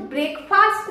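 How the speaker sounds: "ब्रेकफास्ट" with long aa in "faast"; full "fast" ending on "ब्रेकफास्ट"; wrong pronunciation. Shown as a demonstration. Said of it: "नहीं बोलते".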